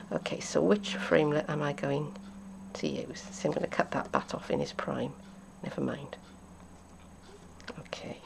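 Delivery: very soft, half-whispered thinking aloud; barely audible private mumble; muttering to self. Real, not acted. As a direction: murmur it to yourself while choosing. Talking to herself while choosing.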